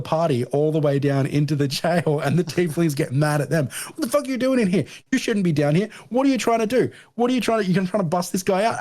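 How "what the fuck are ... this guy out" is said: high, indignant yelling guard; mock angry tiefling. Tieflings yelling at the party in the jail, high and outraged. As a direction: play the outraged guard catching intruders. Tieflings get mad at them.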